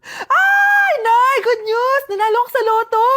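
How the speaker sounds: excited shout. Acted. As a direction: burst in shouting the good news. Daughter shouts lottery win.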